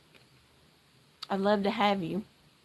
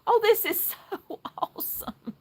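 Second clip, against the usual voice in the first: laughing; 12 semitones higher than usual. Real, not acted. Thrilled by viewer.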